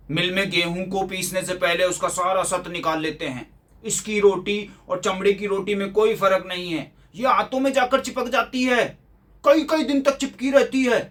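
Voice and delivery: mock the griping father, pitch climbing as it goes on; complaining father.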